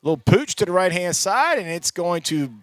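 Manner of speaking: ordinary talk, nothing marked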